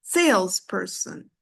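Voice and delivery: "salesperson" stressed SALES-per-son; stress on first syllable. Shown as a demonstration. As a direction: hit SALES and let the rest of "salesperson" fall. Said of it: "it's salesperson".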